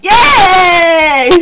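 high, loud, long yay; delighted drawn-out squeal; gleeful cheer. Real, not acted. Cheering as party sets off.

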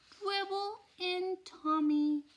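put-on high, slow little toddler voice. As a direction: say it like a pleased little kid.

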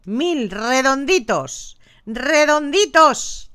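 drawn-out redonditos; slow savoring. Acted. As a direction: dwell happily on the round number. Savoring a round number. (alargando redonditos con gusto)

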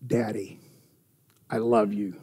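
put-on tender child's love. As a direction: say it softly to your dad, with love. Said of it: "It's like".

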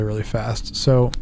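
ordinary delivery

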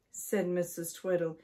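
ordinary delivery, nothing marked

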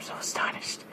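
breathless whisper; whispered astonishment. Real, not acted. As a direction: barely voice it. Hushed after a laugh.

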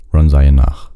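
usual speaking voice

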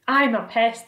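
loud, boastful centipede; proud announcement. A narrator's reading. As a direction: announce it with a broad grin. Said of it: "the centipede announced, grinning".

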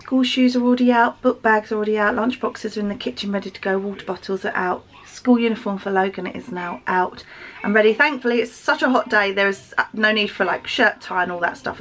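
usual speaking voice

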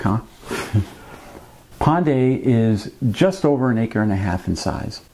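ordinary talk, nothing marked

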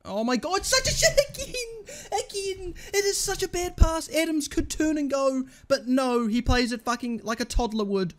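high-pitched disbelief at a botched pass; exasperated rant. Real, not acted. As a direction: voice leaps very high, then gradually descends. Raging at a bad pass.

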